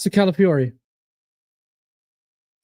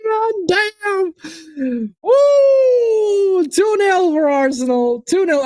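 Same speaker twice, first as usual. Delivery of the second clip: goal cheer; 9 semitones higher, slower than usual. Real, not acted. Fan whooping as Arsenal go two nil, cheering.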